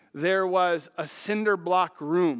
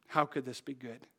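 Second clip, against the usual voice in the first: quiet doubt; 6 semitones lower, 10 dB quieter than usual. Retold or imitated. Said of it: "And I thought".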